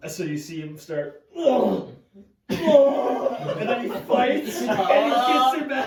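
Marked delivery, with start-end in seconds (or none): 1.4-3.6 mock effortful grunts, louder; straining grunts. Retold straining in arm wrestle.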